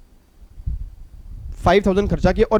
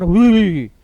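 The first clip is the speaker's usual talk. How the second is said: startled gasps; 5 dB louder than usual. Retold or imitated. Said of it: "एकदम बच्चा कर".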